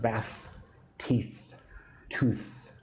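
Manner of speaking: soft "Bath", "teeth", "tooth", each ending in a held unvoiced th; teacher demonstrating the final th in "Bath", "teeth", "tooth"; hard TH sound. Shown as a demonstration. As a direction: separate the words "Bath", "teeth", "tooth" and linger on the th. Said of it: "kind of a hard T H sound".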